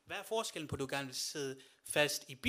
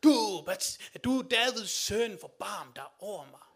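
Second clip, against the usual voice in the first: desperate, louder, slower cry for mercy; crying out even louder; 3 semitones higher, 10 dB louder, slower than usual. Retold or imitated. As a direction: shout over the crowd, stretching the plea. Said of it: "han råber endnu mere".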